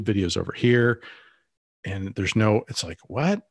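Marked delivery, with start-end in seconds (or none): 3.1-3.4 a short baffled what that rises high. Retold or imitated. Facing a client's scattered marketing, a baffled rising what. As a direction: react as if the mess makes no sense.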